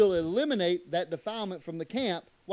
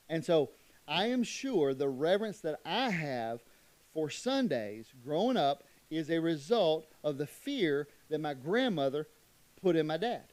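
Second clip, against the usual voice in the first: usual speaking voice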